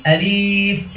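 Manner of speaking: "আলিফ" with the i dragged long; over-lengthened "আলিইইফ"; stretched wrong alif. Shown as a demonstration. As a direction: draw out "লি" in "আলিফ" as a mistake. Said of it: "অনেকে আমরা মাত করি".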